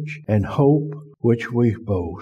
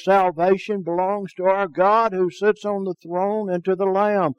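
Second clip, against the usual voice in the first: crying out; 7 semitones higher than usual. Acted. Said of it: "they cried out in a voice".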